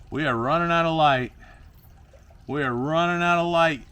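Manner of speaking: high, loud singsong announcement; mock announcement. Vlogger warning the light is going, calling it out high. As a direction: call it out high and drawn.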